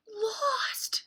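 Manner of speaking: high, breathy cry of Lost; alarmed. Acted. The lost friends crying out in alarm, high and breathy. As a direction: cry it in a panicked hush. Kids realize they are lost.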